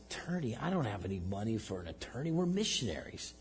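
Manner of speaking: hushed, half-whispered disbelief; stunned. Retold or imitated. Pastor answering his friend about lawyers, hushed and stunned. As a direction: let it slip out in quiet shock.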